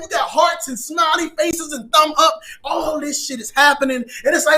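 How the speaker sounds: usual speaking voice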